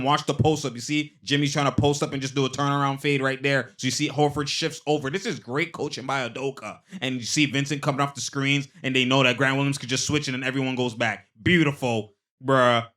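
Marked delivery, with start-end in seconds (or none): none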